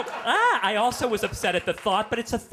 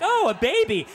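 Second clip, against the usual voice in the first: put-on much higher and louder, squealing "Oh, a baby"; excited little-girl squeal, hammed up; mock delighted surprise; 14 semitones higher, 7 dB louder than usual. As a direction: play the toddler's thrilled gasp. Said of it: "She was like".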